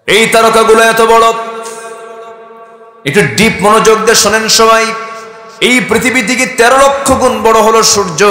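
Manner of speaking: high, loud emphatic proclamation; full-force preaching. Acted. Preacher marvelling at the size of the stars, high and loud. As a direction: hammer it home to the crowd.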